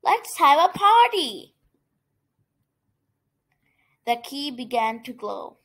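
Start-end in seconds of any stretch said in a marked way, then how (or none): 0.0-1.5 high, excited call; rally everyone with a gleeful raised voice; shouted cheer. A narrator's reading. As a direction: festive crowd's excitement. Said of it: "shouted the people".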